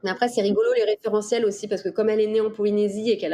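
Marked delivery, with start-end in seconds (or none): none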